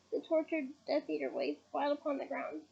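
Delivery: ordinary delivery, nothing marked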